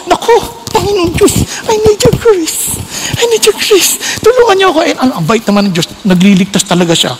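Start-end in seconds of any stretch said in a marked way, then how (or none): 0.1-4.8 pleading prayer. Retold or imitated. Preacher reenacting his prayer under temptation, mild pleading. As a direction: beg God for grace, a touch hammed-up.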